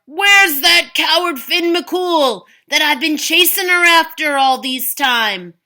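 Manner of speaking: loud, high blustering giant; angry giant. Acted. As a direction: roar the challenge at her. Giant shouting at the door.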